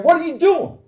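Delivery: high, loud confrontational shout; angry challenge. Retold or imitated. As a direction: call them out sharply. Grown son confronting speeding drivers.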